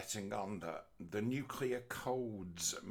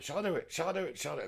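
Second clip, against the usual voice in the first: high loud sing-song goading, over and over; teasing taunt; 7 semitones higher, 4 dB louder, much faster than usual. Pretend. President goading foreign leaders over the launch button, high teasing. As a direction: dangle the threat like playing chicken.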